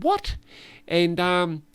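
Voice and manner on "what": "What?" jumps much higher, a mock baffled squeak; retold high bewildered what; high puzzled what. Scaffolder repeats what, high and baffled. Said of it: "and he said".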